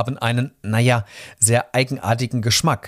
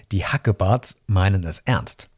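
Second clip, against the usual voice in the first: ordinary delivery, nothing marked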